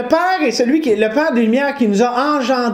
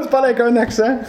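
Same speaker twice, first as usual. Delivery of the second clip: usual speaking voice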